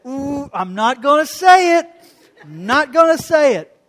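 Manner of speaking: loud, strained "Ooh, I'm not gonna say it"; barely biting his tongue, forced out loud; holding back anger. Retold or imitated. Biting back angry retort.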